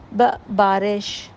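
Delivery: ب said alone, then بارش stretched slowly; slow phonics demo to a child; slow sounding out. Shown as a demonstration. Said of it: "ب کی sound سمجھ آ جائے گی".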